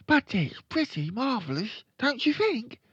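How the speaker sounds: put-on high-pitched little-boy voice; high boy character; young Fred. A narrator's reading. Fred boasts about parrot.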